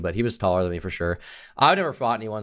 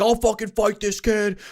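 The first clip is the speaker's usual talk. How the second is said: much higher, drawn-out strained threat; mock raging drunk; 11 semitones higher than usual. Guy dragged away from the bar, hammed-up threatening. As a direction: imitate a hothead being held back.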